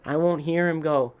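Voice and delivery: ordinary talk, nothing marked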